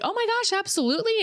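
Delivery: high-pitched imitation of cheerful staff; mock customer-service enthusiasm; eager, pleasant server. Imitating pleasant fast-food staff.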